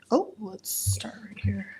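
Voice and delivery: ordinary talk, nothing marked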